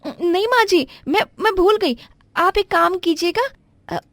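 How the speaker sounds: pitched well up, flustered daughter-in-law apologizing; young daughter-in-law. Acted. Daughter-in-law excuses herself.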